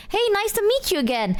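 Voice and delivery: high, polite hello; cheery re-greeting. Retold or imitated. Greeting Junie on second meeting, cheery. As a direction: greet in a lifted, friendly pitch. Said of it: "I go".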